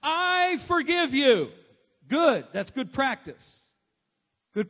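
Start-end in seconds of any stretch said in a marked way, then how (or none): 0.0-1.5 I forgive you, slow, high and loud; congregation repeat. Shown as a demonstration. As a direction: lead them in saying it slowly. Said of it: "Everybody say it with me".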